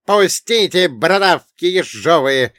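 high, loud, outraged yelling; hollering dwarf. Acted. Dwarf carried under a captor's arm, hollering. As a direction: yell to be let go, high and loud. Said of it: "драл горло карлик".

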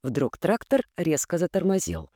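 ordinary delivery, nothing marked

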